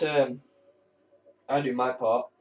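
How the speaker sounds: ordinary delivery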